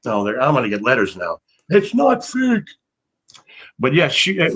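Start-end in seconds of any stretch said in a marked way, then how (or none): 1.7-2.6 high mock shriek of an outraged mom; mother screaming. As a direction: squeal it in her defense of wrestling. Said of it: "scream at me".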